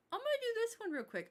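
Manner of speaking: hurried to-do remark; quick aside. Real, not acted. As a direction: rush it out. Hurrying through the list.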